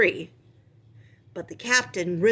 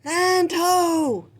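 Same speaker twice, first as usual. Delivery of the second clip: much higher, far-carrying call; lookout's cry; 10 semitones higher, 4 dB louder than usual. Acted. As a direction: call it out from the crow's nest. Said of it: "a cry rang out".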